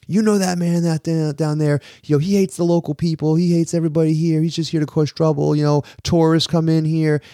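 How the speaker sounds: mock the guy stirring up other diners; belligerent stranger. Stranger warning diners about the father-in-law, high mock ranting voice. Man telling tables about tourists.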